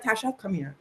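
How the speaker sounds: very soft, gentle call; soft mom. Retold or imitated. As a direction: call her over gently. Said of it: "she was just like".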